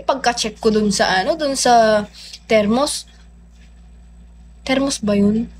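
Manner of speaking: ordinary talk, nothing marked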